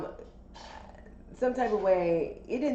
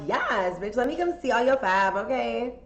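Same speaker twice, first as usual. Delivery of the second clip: higher and louder, gushing sass; excited yes; 7 semitones higher, 7 dB louder, slower than usual. Retold or imitated. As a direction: squeal your yes to the invitation. Excited yes to his invite.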